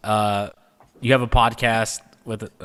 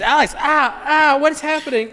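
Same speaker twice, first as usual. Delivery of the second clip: suddenly high, alarmed calling out; startled alarm; 3 dB louder than usual. Real, not acted. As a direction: react to a spill in shock. Host startled by mishap.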